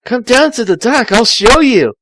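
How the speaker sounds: a lightly voiced young Tails; voicing Tails. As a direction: read it up and bright as the fox. Tails' quoted line.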